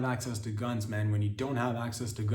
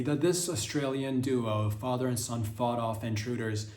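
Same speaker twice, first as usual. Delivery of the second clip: ordinary talk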